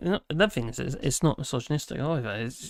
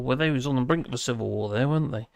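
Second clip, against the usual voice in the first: ordinary talk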